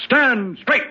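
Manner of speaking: sharp command to a servant; barked order. Acted. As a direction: order him sternly to stand to attention. Master orders servant to attention.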